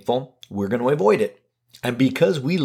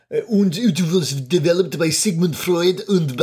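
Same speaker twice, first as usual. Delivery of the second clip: lightly put-on mock German accent; a silly made-up German accent, not meant seriously; Sigmund Freud accent; 3 semitones higher than usual. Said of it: "I'm attributing a made-up, silly accent to Sigmund Freud".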